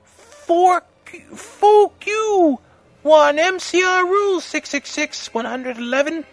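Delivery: very high, loud mock author voice; put-on fanfic author voice. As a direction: pitch it way up for a ranting author's note.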